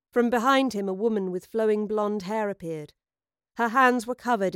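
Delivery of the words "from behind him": plain reading voice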